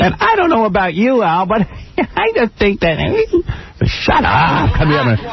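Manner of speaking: high, grinning host voice; big smile in voice. Retold or imitated. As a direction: talk through a huge grin. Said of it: "With a big smile in his voice".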